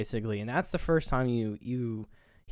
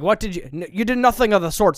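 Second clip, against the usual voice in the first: raised, higher mock reprimand; imitated scolding; 6 semitones higher, 6 dB louder than usual. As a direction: declare it like an old sea dog, lightly acted. Demonstrates Defoe's old-fashioned speech.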